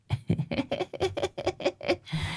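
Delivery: high, soft, rattling titter; mindless giggle. A narrator's reading. Possessed Hoàng Anh tilting the head at Lão Năm, mindless high giggle. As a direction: giggle eerily with no thought behind it. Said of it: "cười vô tri".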